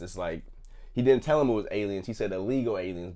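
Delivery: ordinary delivery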